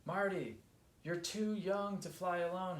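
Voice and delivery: mildly voiced shout after a young flier; calling out. A narrator's reading. Molly calls Marty back from flying alone, calling out. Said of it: "shouted Molly".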